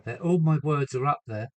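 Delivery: ordinary delivery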